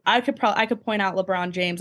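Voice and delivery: normal talking voice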